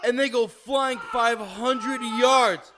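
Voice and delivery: high, loud and slow, stretched out in mock outrage; hammed-up exaggeration. Telling the cohost how far the Karen flew, hammed up high, loud and slow. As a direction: ham up how far she flies.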